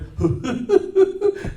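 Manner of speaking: very high cartoon giggle; a squeaky sidekick laugh; Barney's giggle. Shown as a demonstration. Barney laughs at Fred, high and giggly. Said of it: "Barney Rubble".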